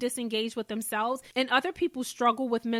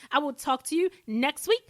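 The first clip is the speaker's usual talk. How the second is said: normal talking voice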